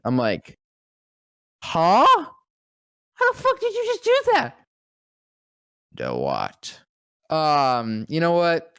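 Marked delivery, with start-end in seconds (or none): 1.6-4.6 high, loud, disbelieving; amazed shock. Retold or imitated. Kid stunned by the actor's long exhale, high and loud. 6.0-6.8 low, soft, deadpan; deep actor voice. Retold or imitated. Voice actor answering the stunned kid, low and deadpan.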